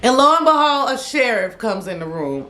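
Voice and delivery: ordinary talk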